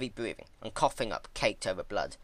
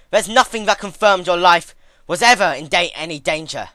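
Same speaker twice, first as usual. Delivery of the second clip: flustered heated argument; 9 semitones higher, 14 dB louder than usual. Acted. Twilight keeps arguing.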